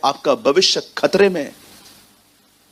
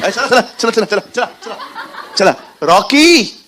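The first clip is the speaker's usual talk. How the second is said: hurrying mother; 5 semitones higher than usual. Acted.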